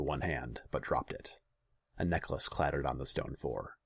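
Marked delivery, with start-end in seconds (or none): none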